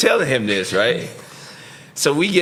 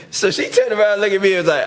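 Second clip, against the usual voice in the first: normal talking voice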